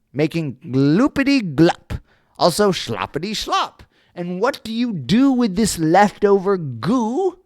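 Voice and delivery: high, loud angry snap; snapping angrily. Acted. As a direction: snap at him, dander up. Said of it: "snapped the Lorax, his dander was up".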